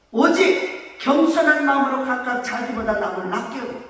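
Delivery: usual speaking voice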